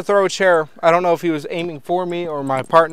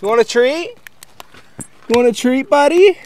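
high dog talk; 8 semitones higher, 6 dB louder than usual. Real, not acted. Offering dog a treat.

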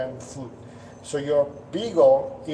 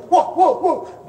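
high, laughing mock bark; playful bark imitation; 13 semitones higher, 5 dB louder than usual. Trainer imitating a playful dog's bark, high and laughing. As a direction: bark like a dog that wants to play.

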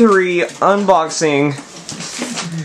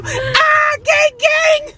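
hype shout; much slower than usual. Real, not acted. Hyped riding shout.

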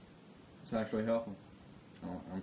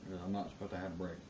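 normal talking voice

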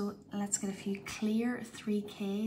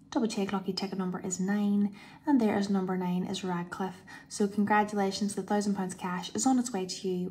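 ordinary talk, nothing marked

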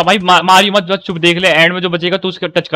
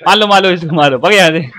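ordinary talk

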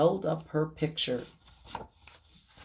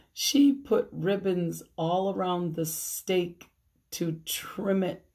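ordinary delivery

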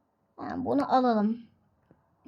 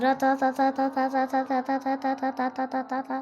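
a long rattling mouth-made motor noise; engine rattle. Pretend. Gamer revs the taxi, making a rattling engine noise.

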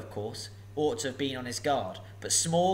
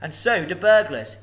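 louder cheeky jab; parting shot; 5 semitones higher, 10 dB louder than usual. Acted. Bilbo fleeing from Smaug, cheeky parting shot. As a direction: fire it off over your shoulder. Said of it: "as a parting shot".

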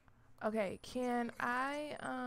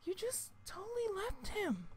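shocked; 9 semitones higher than usual. Real, not acted. Pet runs off in the game.

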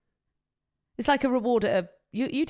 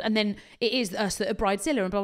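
ordinary delivery

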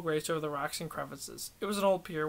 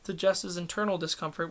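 plain reading voice

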